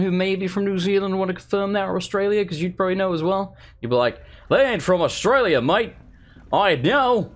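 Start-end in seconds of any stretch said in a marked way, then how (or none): 4.5-7.3 hammed-up Aussie impression; attempted Australian accent. Imagining an Aussie viewer replying, mock Australian accent. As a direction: have a rough go at an Australian accent. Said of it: "trying to do an Australian impression".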